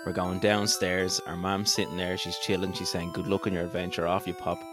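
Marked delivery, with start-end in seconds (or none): none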